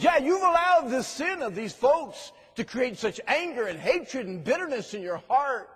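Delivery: put-on preacher recalling how he confronted the girl; firm loud rebuke. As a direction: press the point hard. Preacher retells his firm rebuke.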